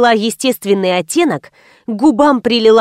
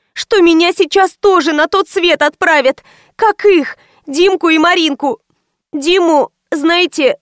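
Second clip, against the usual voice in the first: put-on high-pitched, anxious young woman's voice; frightened girl; 7 semitones higher than usual. A narrator's reading. Анжела confiding her fear of being killed, high and anxious. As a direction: play a scared girl, pitch held high.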